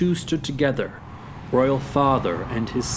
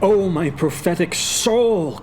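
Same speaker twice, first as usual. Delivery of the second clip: slow, higher anguished exclamation; crying out; 5 semitones higher than usual. Acted. Hamlet hearing the killer revealed, crying out. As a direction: exclaim in dismay. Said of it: "cried Hamlet".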